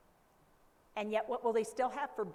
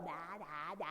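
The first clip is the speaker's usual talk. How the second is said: put-on low, soft baby babbling; baby babble; 6 semitones lower, 10 dB quieter, slower than usual. A baby babbling to mom, low and soft. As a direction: babble like an infant, softly. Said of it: "you go".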